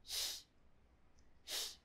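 quiet, isolated ich-sound; soft front ch. Shown as a demonstration. German teacher on everything else with C H, soft front ch. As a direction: hiss the ch softly forward. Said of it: "Everything else you pronounce".

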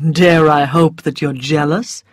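a hint of mocking Rhett. A narrator's reading. Rhett voiced in story.

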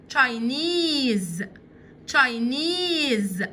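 تشاينيز slow, with the s said as a z; teacher modelling Chinese with a z sound. Shown as a demonstration. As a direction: stretch تشاينيز, buzzing the final z. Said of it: "هنطقها كانها زد".

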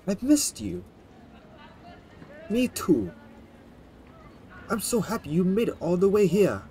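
high, loud, delighted greeting; overjoyed, pitch lifted right up; gushing reunion. Acted. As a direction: gush happily at a long-missed friend. Acted happy reunion of characters.

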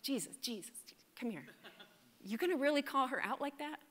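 hushed disciple pulling Jesus aside; soft embarrassed hiss to the teacher; urgent aside. Retold or imitated. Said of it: "can't you just see one of them going".

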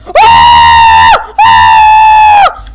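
two loud, drawn-out screams; pretend burning screams. Player voicing a character on fire, loud screams. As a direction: scream as if set alight.

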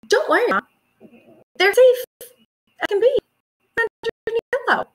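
put-on high, eager little-girl voice; little Jane. Little Jane reassuring Mom about the worms, high child voice. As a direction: pitch way up and reassure like a small child.

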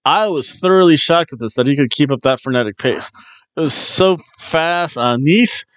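normal talking voice

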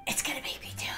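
breathy, fearful whisper; whimpered whisper. Real, not acted. Dreading the gross task, whispering fearfully. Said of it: "this is my nightmare".

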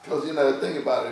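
ordinary talk

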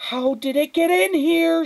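high, loud alarmed shout; shrieked question. A narrator's reading. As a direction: demand an answer at the top of the voice. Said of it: "shouted Flora's mother".

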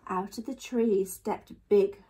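ordinary delivery

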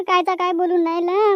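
ordinary talk, nothing marked

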